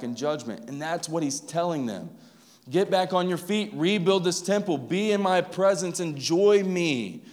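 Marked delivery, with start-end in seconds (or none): 2.7-7.2 raised, higher, louder commanding call from God; voicing God. Pastor voicing God calling his people to rebuild, raised and commanding.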